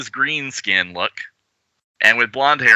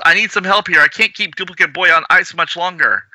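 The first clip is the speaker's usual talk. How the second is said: strained call for help; 8 semitones higher than usual. Acted. Jules calls for help.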